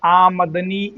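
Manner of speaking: आमदनी as आमद plus नी run together; joined correct pronunciation. Shown as a demonstration. Teacher telling students to read together, आमदनी as joined आमद-नी. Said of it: "एक साथ पढ़िए".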